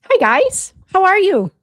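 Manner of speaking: high, loud sing-song hello; bright greeting. Real, not acted. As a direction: greet them with big energy.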